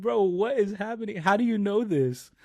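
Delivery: high, surprised quoting voice; bro, how do you know this; surprised high quote. Retold or imitated. Friend quoting surprised reaction.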